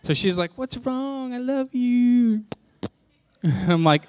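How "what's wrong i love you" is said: high-pitched, drawn-out mock sweetness; nurturing wife. Wife coaxing a sulking spouse, exaggerated tenderness. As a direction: coo it very high, stretching the words. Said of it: "'cause she's a nurturer".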